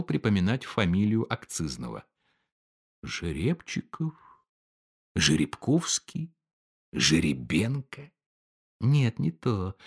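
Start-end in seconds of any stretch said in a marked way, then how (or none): 3.0-9.8 softer, slow mumbling of names; musing aloud. A narrator's reading. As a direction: search your memory quietly. Clerk searching memory aloud.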